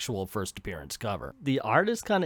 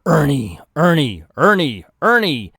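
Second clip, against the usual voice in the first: loud, insistent repeated calling; loud chant-like summons; voices growing louder; 10 dB louder than usual. Acted. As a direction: call the name louder, climbing in pitch. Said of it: "we hear voices grow louder".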